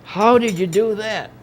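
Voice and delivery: loud, high, drawn-out disbelief; stretched-out, high-pitched mock amazement; retold astonishment. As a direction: imitate a flabbergasted outcry. Retold astonishment on seeing the book.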